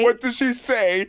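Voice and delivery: high, slow, mock-outraged; drawn-out mock indignation; indignant dude voice. Echoing the impression.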